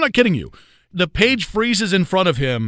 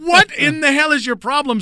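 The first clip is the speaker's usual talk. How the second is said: much higher, exasperated mock yell; mock outburst; 10 semitones higher than usual. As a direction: blow up at the rookie, half in jest. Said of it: "I lost my mind on him".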